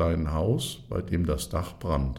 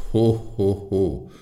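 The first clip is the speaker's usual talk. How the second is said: louder booming "ho ho"; hearty laugh; 2 semitones higher, 5 dB louder, slower than usual. A narrator's reading. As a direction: laugh it off like a big boss. Fire chief laughs again.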